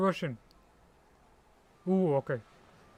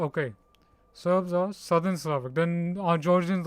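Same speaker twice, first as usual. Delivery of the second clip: usual speaking voice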